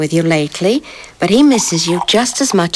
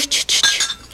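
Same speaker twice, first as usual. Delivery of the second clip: shushing; 5 dB quieter than usual. Acted. Mother hushing the baby.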